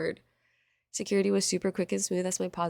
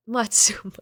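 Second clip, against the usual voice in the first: whisper; 5 dB louder than usual. Real, not acted. Whispered name after laugh.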